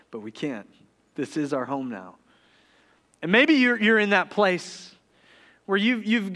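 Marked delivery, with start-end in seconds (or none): 0.1-2.2 very soft, low fatherly reply; hushed, regretful acceptance; gentle, sad resignation. Retold or imitated. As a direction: break it quietly to a homesick child. Father gently refusing daughter's plea.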